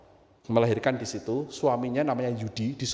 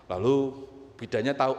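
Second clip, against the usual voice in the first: normal talking voice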